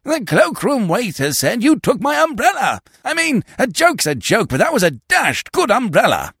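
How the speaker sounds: put-on high-pitched, outraged young club man; worked-up, spluttering grievance; indignant protest. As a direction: complain bitterly at being robbed. Pink youth protests indignantly.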